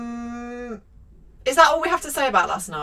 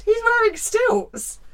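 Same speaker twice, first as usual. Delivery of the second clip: mock incredulous telling; 12 semitones higher, much slower than usual. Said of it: "I'd be telling every other person".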